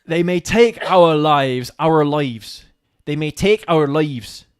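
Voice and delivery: put-on Bristolian accent sliding into Scottish, slow and loud; attempted Bristolian accent. Player trying Bristol but going Scottish, loud drawn-out accent. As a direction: declaim it slowly in a muddled Bristolian-Scottish accent. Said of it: "If you think of a Bristolian accent".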